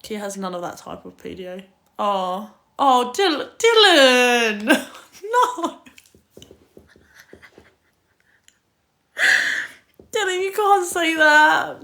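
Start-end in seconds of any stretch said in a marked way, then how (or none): 2.0-11.8 real shocked outcry. Real, not acted. Reacts to a viewer's comment.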